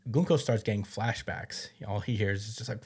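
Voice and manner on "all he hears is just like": normal talking voice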